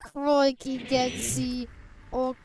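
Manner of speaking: overdone Aussie exclamation; Australian accent. Pretend. Streamer addressing chat in bad Australian accent. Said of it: "That wasn't even good Australian".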